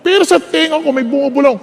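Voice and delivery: ordinary talk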